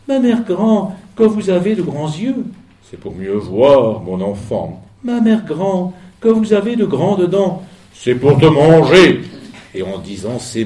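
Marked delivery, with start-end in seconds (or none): 0.1-2.4 fillette étonnée. Acted. La fillette s'étonne. 2.9-4.8 voix grave du loup. Acted. Le loup déguisé répond. 5.0-7.6 fillette étonnée. Acted. La fillette s'étonne. 7.9-9.2 loud slow roar of the wolf; loup qui rugit. Acted. Le loup bondit sur elle.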